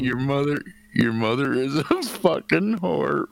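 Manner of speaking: trying not to laugh. Real, not acted. Poet retrying own rude rhyme after being teased, trying not to laugh. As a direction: push the line out before laughter takes over. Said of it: "You can't read it without laughing".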